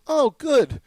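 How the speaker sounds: high, loud, delighted "oh"; imitating a relieved reaction; mock relief. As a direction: pitch it way up, pleased. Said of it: "there's a general sense of".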